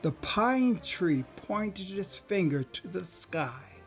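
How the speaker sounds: ordinary delivery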